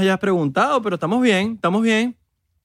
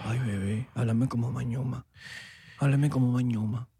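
low, softened voice; hushed, low mock sweet-talk; mock-intimate murmur; 4 semitones lower, 8 dB quieter than usual. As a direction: coo it playfully close to the mic.

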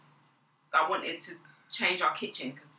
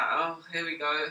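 low, slow, softer groan of resignation; mild retold resignation; 4 semitones lower, 6 dB quieter than usual. Mum recalls hearing the decorating plan, mildly weary resignation. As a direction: sink down slowly, as if here comes trouble.

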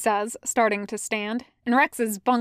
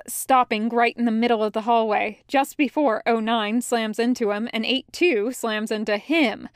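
ordinary delivery, nothing marked

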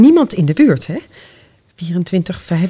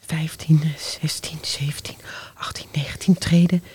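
whisper; 4 semitones lower, 8 dB quieter than usual. Acted. Counting steps fearfully.

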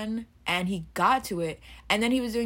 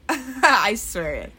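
laughing; 6 semitones higher, 9 dB louder, much slower than usual. Real, not acted. Laughing after joke.

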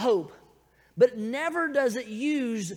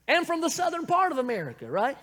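higher, playful Southern American accent; proud Southern American accent, getting louder; 4 semitones higher, 4 dB louder, faster than usual. Real, not acted. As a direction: lean into the Southern American accent, lifting the pitch. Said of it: "this accent is from".

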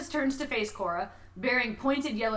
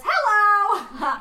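cheery loud greeting; 8 semitones higher, 9 dB louder than usual. Acted. Cora greets Krampus cheerfully.

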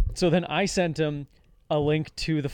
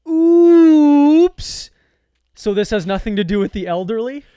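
much higher and louder mock yell; caps-lock shout; 10 semitones higher, 13 dB louder, slower than usual. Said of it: "And then I put in caps locks".

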